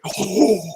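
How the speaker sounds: imitated commercial-style icy gasp; big, high gasp of fresh Arctic air; deep breath gasp. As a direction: mimic a lozenge-ad breath rush.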